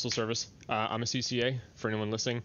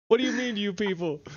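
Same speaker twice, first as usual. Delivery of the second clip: laughing mock offense; 9 semitones higher than usual. Real, not acted. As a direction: feign offense through laughter.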